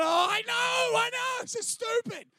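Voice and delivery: a high, loud hammed-up sneer; mocking shout. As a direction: shout scorn at the skit. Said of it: "And I'm going".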